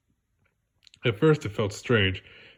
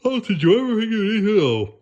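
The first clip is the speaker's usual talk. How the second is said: boastful Patrick; 10 semitones higher, 5 dB louder, faster than usual. Acted.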